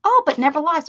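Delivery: normal talking voice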